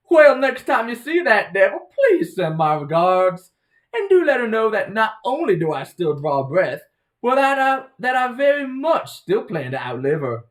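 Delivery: hammed-up Southern belle, high and loud; put-on Southern belle accent, proudly defiant. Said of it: "Your dog sounds like a Southern belle".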